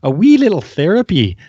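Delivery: light mock Scottish lilt on "wee little"; a playful Scottish twang on "wee"; a touch of Scottish, teasing; Scottish accent. Real, not acted. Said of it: "pulling out the Scottish accent".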